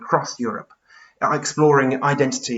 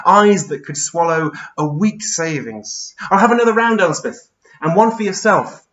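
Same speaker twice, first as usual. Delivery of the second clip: emphatic poetic reminiscence, building louder; Charles Byrne, reminiscing; 4 semitones higher, 5 dB louder, slower than usual. Acted. Giant remembers Elspeth at the Royal and orders a round, reminiscing. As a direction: savour the memory, then call out the order.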